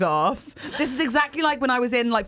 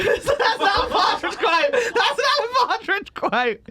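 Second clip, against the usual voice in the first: high, slow, laughing objection; laughing protest; 9 semitones higher, slower than usual. Real, not acted. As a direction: protest helplessly through laughter.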